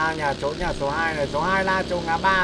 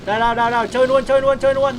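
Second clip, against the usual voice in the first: excited shout; 8 semitones higher, 9 dB louder, faster than usual. Real, not acted. Excited firefight callouts.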